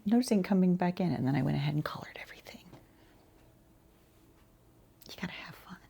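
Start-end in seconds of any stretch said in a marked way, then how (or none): none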